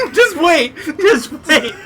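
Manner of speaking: high, laughing voice, almost crying; laughing plea. Real, not acted. Asking his friend to wait, laughing hard. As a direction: beg them to hold on through laughter.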